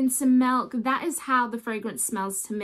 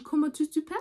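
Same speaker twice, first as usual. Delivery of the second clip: mock French; 4 semitones higher, much faster than usual. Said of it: "in my French accent".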